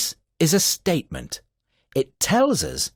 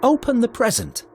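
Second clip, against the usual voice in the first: bossy command; 10 semitones higher than usual. Shown as a demonstration. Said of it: "This is a command".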